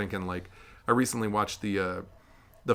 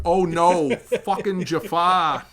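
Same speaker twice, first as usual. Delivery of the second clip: high, loud, very slow Boston groan; Boston accent; 8 semitones higher, 7 dB louder, much slower than usual. Retold or imitated. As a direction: stretch it out in a thick Boston accent. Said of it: "It's just in a Boston accent".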